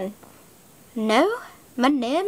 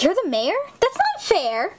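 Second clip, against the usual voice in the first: usual speaking voice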